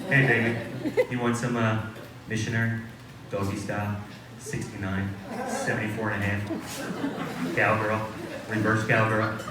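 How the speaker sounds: sexy voice. Acted. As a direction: croon it like a seducer. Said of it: "That's my sexy voice".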